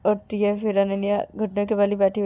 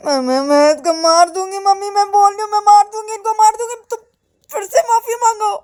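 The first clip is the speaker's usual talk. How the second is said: high, loud angry outburst climbing higher; enraged threat; 11 semitones higher, 8 dB louder than usual. Acted. Enraged girl threatening her friend before mummy, shrill climbing shout. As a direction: stammer into a screamed threat. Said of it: "और भी गुस्सा हो जाती है".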